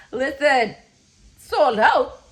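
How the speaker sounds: loud, high, disbelieving; incredulous. Real, not acted. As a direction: demand it in outrage. Disbelief at sold-out tickets.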